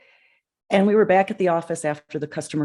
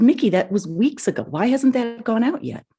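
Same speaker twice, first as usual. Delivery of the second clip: mildly irritated, higher-pitched imitation of a boss; frustrated boss; 8 semitones higher than usual. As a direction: retell the boss's exasperated question, pitch climbing. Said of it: "irritated. He was just frustrated".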